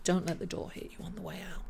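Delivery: a quick throwaway send-off; tossed-off dismissal. Pretend. Astrologer repeating the quip to viewers, fast soft aside. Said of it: "It's that kind of energy".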